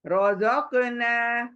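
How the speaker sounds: रौज़क़ना slow with a thick र; heavy ra, slow. Shown as a demonstration. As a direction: model रौज़क़ना for students to repeat. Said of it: "रॉ को पुर पढ़ना ही पढ़ना है". (रौज़क़ना धीरे, र पुर)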